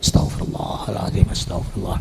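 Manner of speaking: very low, soft murmured dhikr; contoh istighfar. Shown as a demonstration. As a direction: murmur it under your breath. (gumam zikir pelan dan rendah)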